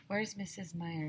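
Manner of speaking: ordinary delivery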